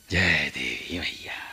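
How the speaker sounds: slow, hushed whispered invocation; whispered prayer. Acted. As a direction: breathe the goddess's name under your breath. Prayer at the temple.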